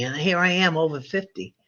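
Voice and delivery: normal talking voice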